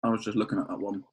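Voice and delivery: talking with ice in mouth; mouth full of ice. Real, not acted. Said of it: "sorry, I've got a lot of ice in my mouth".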